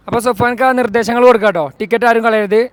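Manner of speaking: loud, pumped-up vlog voice; energetic. Real, not acted. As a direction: turn the energy way up. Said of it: "നമ്മൾ energetic ആയിട്ടില്ല എന്നുള്ളത്".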